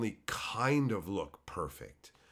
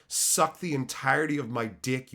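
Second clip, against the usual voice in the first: ordinary talk, nothing marked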